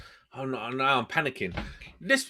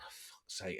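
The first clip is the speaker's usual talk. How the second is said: muttered curse; 6 semitones lower, 21 dB quieter than usual. Real, not acted. Muttered curse on seeing house.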